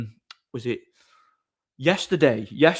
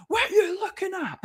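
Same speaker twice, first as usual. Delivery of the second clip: very high-pitched mocking yell, half laughing; mock shout. Junkie shouting over at the doorman, mock high-pitched shout. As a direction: imitate a distant shouter in a silly high voice. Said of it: "this guy shouts over".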